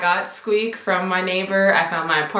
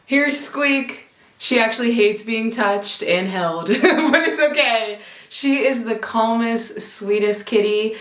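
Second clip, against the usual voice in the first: pet voice; 5 semitones higher than usual. Real, not acted. Talking to her kitten.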